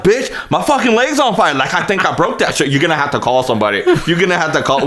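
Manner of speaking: high-pitched retold panic; freaking out. Telling his buddy the leg is broken, high panic. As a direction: plead in a hurry, pitched well up. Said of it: "I was freaking out and everything".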